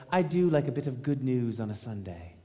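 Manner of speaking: put-on English accent of a royal lady; mock English accent for a quote. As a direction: do your best English accent, as the Queen Mother. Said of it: "That's my best English accent".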